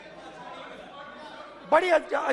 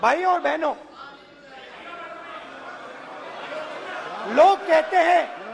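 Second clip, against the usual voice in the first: booming political rally speech. Real, not acted. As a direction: address the crowd like a rally stage. Addresses brothers and sisters rally-style.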